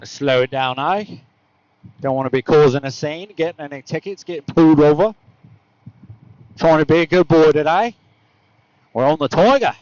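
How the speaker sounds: mock Aussie voice, rising at phrase ends; playful, badly faked Australian; butchered Australian accent. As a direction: put on a rough Australian accent. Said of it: "I just butchered your accent".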